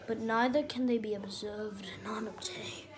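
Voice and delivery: hushed documentary narrator whisper; nature documentary. Pretend. Dad narrates dog like documentary.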